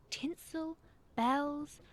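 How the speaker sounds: ordinary delivery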